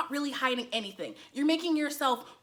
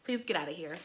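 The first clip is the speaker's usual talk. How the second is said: weary retort; 8 semitones lower, 10 dB quieter than usual. Retold or imitated. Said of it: "I'm like".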